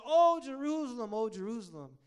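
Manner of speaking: high, loud lament that then eases; mourning cry. Retold or imitated. Jesus lamenting over Jerusalem as the preacher retells it, mourning. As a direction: cry out in grief, then let it fall. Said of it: "Jesus, he's mourning over".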